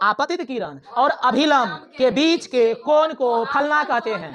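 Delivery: drawn out model answer for students; slow recital. Shown as a demonstration. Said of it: "teacher models the definition". (धीमी ज़ोरदार परिभाषा)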